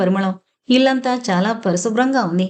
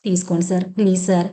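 pleading; 2 semitones lower than usual. A narrator's reading. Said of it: "ప్రాధేయపూర్వకంగా అడిగాడు".

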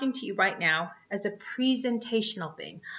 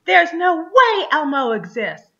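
higher and louder, a disbelieving heckler; heckler's outburst; 8 semitones higher, 8 dB louder than usual. Retold or imitated. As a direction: blurt it out at the show, higher and louder. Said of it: "and somebody next to you says".

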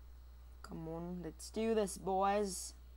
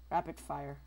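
ordinary talk

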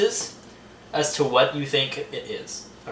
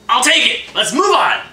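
loud, high and upbeat; excited shout; 8 semitones higher, 6 dB louder than usual. Real, not acted. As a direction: burst out with glee. Excited verdict after tasting.

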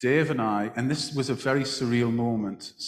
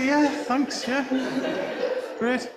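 much higher, sarcastic forced cheer; mock enthusiasm. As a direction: fake a bright thank-you you don't mean. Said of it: "I was like".

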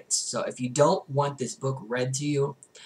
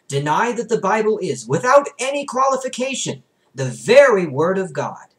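rising emphasis; 9 semitones higher, 11 dB louder than usual. A narrator's reading.

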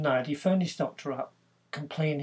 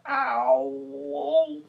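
sharp, high yelp of pain, louder; yell of pain; 11 semitones higher, 6 dB louder than usual. Retold or imitated. Harry yelps at the doctor, high and loud. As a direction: yelp as the knee is touched. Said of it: "and he yelled".

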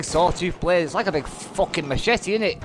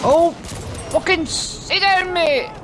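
much higher, slow mocking drawl; sing-song taunt; 10 semitones higher, slower than usual. Real, not acted. Gamer mocking the enemy he just killed, high and slow. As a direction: drag it out to rub it in.

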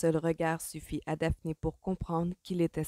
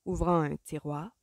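plain reading voice

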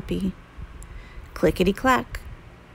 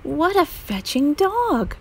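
higher, slow delighted exclaim; excited exclaim; 8 semitones higher than usual. Acted. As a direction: gush over the dog. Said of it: "Bonaparte exclaimed".

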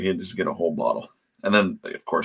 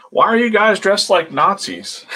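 much higher and louder, cheeky needling voice; high loud taunting question; mock provocative question; 12 semitones higher, 6 dB louder than usual. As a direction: needle them like a brash kid. Andy provoking wrestlers on camera.

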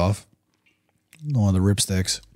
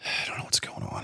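tired breathy whisper after a sigh; 11 dB quieter than usual. Real, not acted. Dad with a failing voice, whispering wearily. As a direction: let it out on a weary sigh. Sighing, voice nearly gone.

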